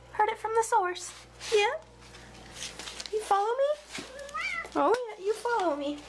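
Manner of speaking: usual speaking voice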